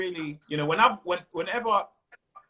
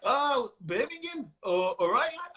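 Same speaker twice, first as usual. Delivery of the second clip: badly faked Birmingham accent, pitched up; attempted Birmingham accent; 6 semitones higher, slower than usual. Comic trying the local accent on a Birmingham crowd, Birmingham accent. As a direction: have a clumsy go at Brummie. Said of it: "I tried to do a Birmingham accent".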